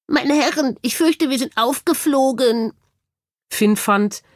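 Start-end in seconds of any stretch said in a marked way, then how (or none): 0.1-2.7 higher, croaky cockatoo voice; croaking bird. A narrator's reading. Bird warning the team they are exposed, croaking. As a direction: croak like a bird with a heavy cold. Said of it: "krächzte der Vogel".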